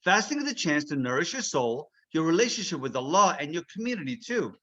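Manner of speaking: usual speaking voice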